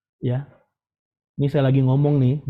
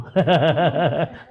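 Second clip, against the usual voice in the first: real rippling chuckle; amused laugh; 2 semitones higher than usual. Real, not acted. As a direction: laugh at your own joke. Laughs at own phone-number joke.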